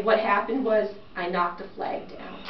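ordinary delivery

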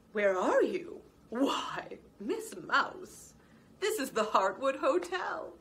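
high, fussy hotel clerk; polite lizard concierge. A narrator's reading. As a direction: greet the guest with brisk courtesy.

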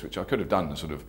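ordinary delivery

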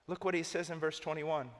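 usual speaking voice

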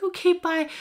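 a quick, high take on mom saying bye; imitating mom. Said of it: "She's like".

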